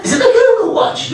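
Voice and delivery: put-on very high, excited old woman; old lady voice. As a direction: squeak the question like an impressed granny.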